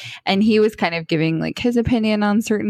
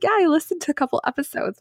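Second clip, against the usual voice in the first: high fan voice; 9 semitones higher, faster than usual. Retold or imitated.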